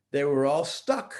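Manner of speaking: ordinary delivery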